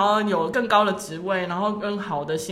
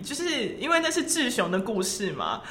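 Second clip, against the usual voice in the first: ordinary talk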